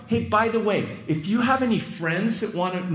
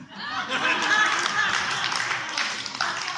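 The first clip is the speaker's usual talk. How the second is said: laughter; 3 dB quieter than usual. Real, not acted. Woman's reveal lands, laughter.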